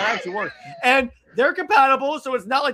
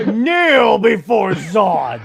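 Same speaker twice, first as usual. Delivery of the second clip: Zod impression; 7 dB louder, much slower than usual. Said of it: "That's great".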